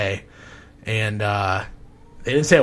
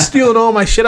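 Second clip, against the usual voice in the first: high, loud mimicked outrage; screamed accusation; 12 semitones higher, 12 dB louder than usual. Son accusing the inspector of stealing, screaming. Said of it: "screaming at his mom".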